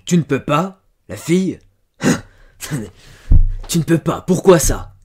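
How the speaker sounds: loud, high, indignant shouting; sudden outburst of temper. A narrator's reading. Farmer to his refusing servant girl, flying into a rage. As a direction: explode at her in disbelief. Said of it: "il s'emporta tout à coup".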